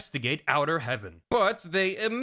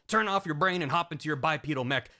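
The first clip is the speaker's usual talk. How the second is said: normal talking voice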